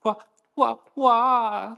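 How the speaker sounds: high, tiny trembling whimper; Chiikawa-style whimper. Retold or imitated. Terrified captive whimpers like Chiikawa, high squeaky cry. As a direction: squeak like a frightened tiny creature. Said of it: "わなわな震えながら声も発せずに".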